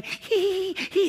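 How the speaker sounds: high-pitched imitation of a girlish giggle; cutesy mock giggle.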